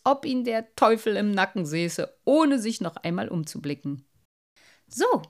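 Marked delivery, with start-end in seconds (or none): 4.9-5.2 high, perky little merman; bright, pleased little exclamation; cheerful. A narrator's reading. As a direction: pipe up merrily. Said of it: "sagte der kleine Wassermann fröhlich".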